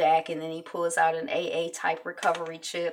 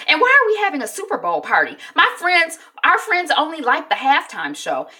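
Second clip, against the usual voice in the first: higher, louder whiny protest; indignant complaint; 10 semitones higher, 9 dB louder than usual. Retold or imitated. Tessa objects to the Super Bowl party, indignant. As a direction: imitate an annoyed kid griping at parents.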